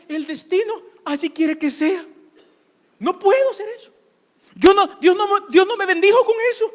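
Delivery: high, loud mocking voice of a defeated believer; mock whiny believer. Pastor mocking the congregation's fatalistic excuses, high loud whine. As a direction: ham up the self-pitying excuse, high and loud. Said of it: "Tú piensas".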